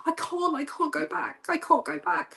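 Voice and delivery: high, pleading protest in mock fear; retold panic. To the author, begging not to return, high and fearful. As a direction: replay the panic of refusing to go back.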